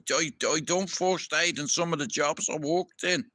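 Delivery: normal talking voice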